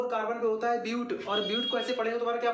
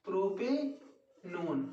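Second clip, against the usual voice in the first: Propanone drawn out very slowly and softer; dictating slowly; 2 semitones lower, 4 dB quieter, much slower than usual. Shown as a demonstration. Teacher dictates propanone for students to copy, very slow.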